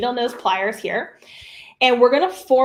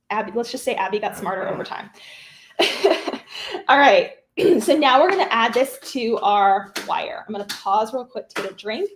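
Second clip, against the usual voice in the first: ordinary delivery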